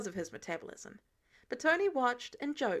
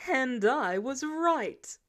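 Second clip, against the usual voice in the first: slow, smug and a little louder; savouring the win; bragging; 2 semitones higher, 5 dB louder, much slower than usual. Acted. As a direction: drag it out, proud of being right. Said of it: "Tony bragged".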